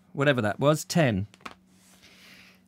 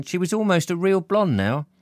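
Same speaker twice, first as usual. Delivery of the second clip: ordinary delivery, nothing marked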